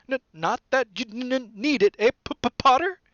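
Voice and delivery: high, jittery stutter; nervous stammer. A narrator's reading. Quirrell joking nervously with Harry, high stammer. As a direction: stammer through a feeble joke. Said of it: "He laughed nervously".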